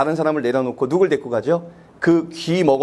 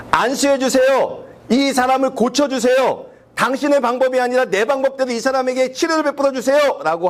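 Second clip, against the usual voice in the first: insistent demand; 8 semitones higher than usual. Retold or imitated. People demanding their own way. (높고 느리게 자기 방식을 요구하며)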